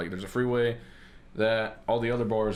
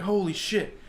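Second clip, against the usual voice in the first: high-pitched mock amazement; pitched-up retold astonishment; amazed; 10 semitones higher, slower than usual. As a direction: imitate a stranger's astonished discovery. Retold amazed reaction of Boston fan.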